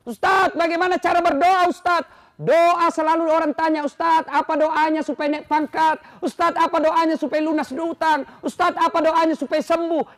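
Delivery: loud, high sermon voice with voiced questions; full-force preaching. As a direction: drive each question home like a preacher. (khotbah lantang dan tinggi)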